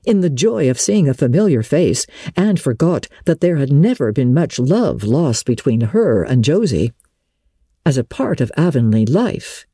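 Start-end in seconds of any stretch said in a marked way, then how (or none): none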